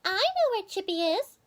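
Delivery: high, knowing chipmunk voice; chipmunk wife. Acted. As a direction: let on that you know, pitched high. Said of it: "said Missus Chippy Hackee".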